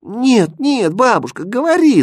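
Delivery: high, loud pleading cries; acted shouting grandchildren; children's clamour. A narrator's reading. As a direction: beg granny eagerly, all excited. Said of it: "закричали внуки".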